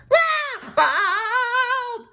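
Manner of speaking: high, loud drawn-out cartoon shriek; piercing full-force scream; screech. A narrator's reading. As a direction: screech in horror at the sight. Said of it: "screeched SpongeBob".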